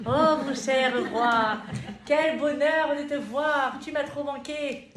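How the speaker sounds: high, loud, slow adoring greeting; gushing queen voice. Acted. Esther greeting her king, high, loud and gushing. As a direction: pour on the sweetness like a smitten queen. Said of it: "C'est moi".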